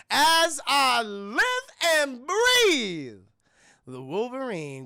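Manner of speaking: slow, stretched-out slimy greeting; high, loud slimy drawl that drops at the end; slimy villain. Retold or imitated. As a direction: savor each word like an oily villain. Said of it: "Juicy sounds so slimy".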